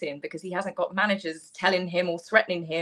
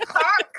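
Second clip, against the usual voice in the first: laughing honk, higher and louder; goose honk imitation; 8 semitones higher, 6 dB louder than usual. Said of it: "I just always honk".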